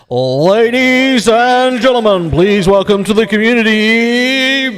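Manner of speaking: slow, booming ringmaster welcome; announcer voice. Pretend. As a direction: announce her like a grand MC. Mock formal announcement.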